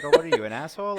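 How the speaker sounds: mock challenge. Co-host playing someone calling out an asshole, laughing mock challenge. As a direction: toss it out as a joking jab, laughing.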